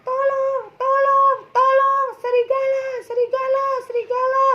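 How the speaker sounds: very high, loud scream for help; boy screams as the wolf attacks, terrified; desperate scream. Acted. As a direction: scream it in real panic. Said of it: "Anak itu pun teriak".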